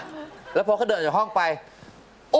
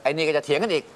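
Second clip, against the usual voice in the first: ordinary delivery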